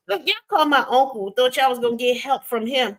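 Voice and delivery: ordinary delivery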